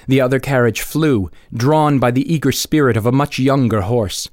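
plain reading voice

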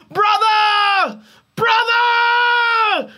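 very high, loud anguished cry to the sky; desperate shout. A narrator's reading. Older brother yelling to the heavens in despair, anguished shout. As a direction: cry out with everything left. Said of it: "yelled to the heavens".

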